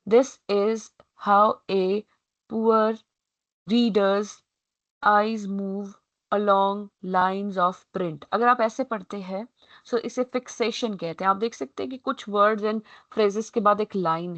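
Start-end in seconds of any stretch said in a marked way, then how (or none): 0.1-8.2 slow, halting, a pause after every word; poor reader's reading. Shown as a demonstration. Teacher shows the class how a poor reader plods word by word. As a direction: read it word by word like a struggling reader. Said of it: "The poor reader would read like this".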